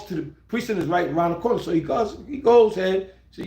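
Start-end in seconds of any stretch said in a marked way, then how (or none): none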